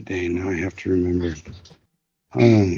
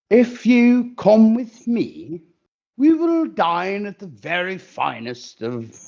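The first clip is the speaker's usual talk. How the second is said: slow, high, grand bulldog voice; posh bulldog; 5 semitones higher, slower than usual. Acted. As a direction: woo her like a pompous gentleman dog.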